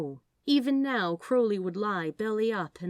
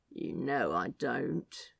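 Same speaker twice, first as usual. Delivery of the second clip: tired serpent; 8 semitones lower, 4 dB quieter, slower than usual. Acted. Crowley as exhausted serpent.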